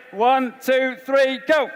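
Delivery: called out high and loud to the hall; rally the room with a high, loud count; loud countdown. Real, not acted. Counting down for the crowd.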